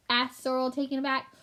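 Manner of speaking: ordinary delivery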